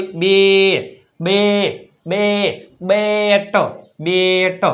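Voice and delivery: બે held long, then બેટ with a separate final ટ; reading by sounds. Shown as a demonstration. Teacher modelling how to read બેટ, vowel held long. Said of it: "વાંચો જોઈ".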